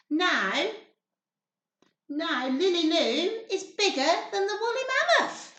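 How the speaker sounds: ordinary delivery, nothing marked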